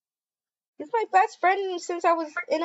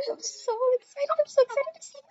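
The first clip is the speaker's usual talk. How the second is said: excited squeal; 10 semitones higher, faster than usual. Real, not acted. Waiting for friend to arrive.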